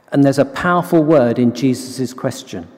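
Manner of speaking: usual speaking voice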